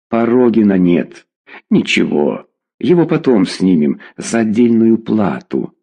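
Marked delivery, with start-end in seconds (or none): none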